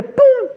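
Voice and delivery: high, punchy "pum"; sudden mouth-made plop; imitated drop impact. Teller showing another drop striking, sudden pop.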